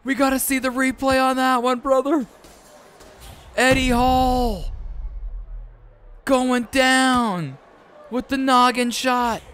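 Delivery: high, loud excited yelling; excited shout. Real, not acted. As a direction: yell it like a knockout call. Calling a knockdown live.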